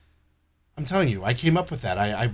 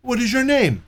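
much higher mock question; mock caller; 10 semitones higher than usual. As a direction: squeak it like the scammer. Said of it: "And he goes".

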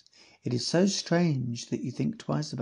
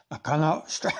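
Bob voice; 2 semitones higher than usual. Retold or imitated. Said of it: "this sounds like a dog".

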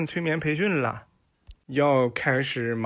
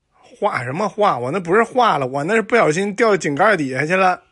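higher and louder, indignant retort; indignant teacher; 5 semitones higher, 7 dB louder than usual. Acted. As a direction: snap back at the student, louder. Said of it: "老师说".

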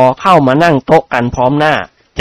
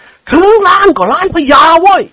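angry shout. A narrator's reading. Old nobleman shouting back at students, furious high yell. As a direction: shout back shaking with rage. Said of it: "โกรธจนตัวสั่น ท่านหันมาร้องตะโกน".

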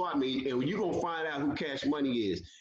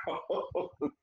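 stunned whisper; 4 dB quieter than usual. Retold or imitated. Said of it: "I was like".

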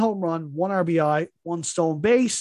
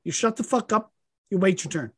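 mock scolding; 3 dB louder than usual.

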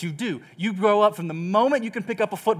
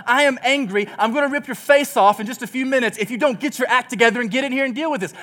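ordinary delivery

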